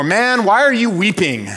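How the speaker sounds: hammed-up annoyed relative; exasperated family version. Preacher imitating how his family would say it, mock exasperated. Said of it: "in our family, it would be more".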